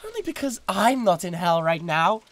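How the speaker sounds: sneering jab in a high voice; mock the rival with a high, haughty sneer; high, catty put-down. A demon sniping at her rival over his boasts, catty.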